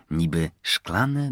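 usual speaking voice

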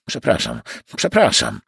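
ordinary delivery

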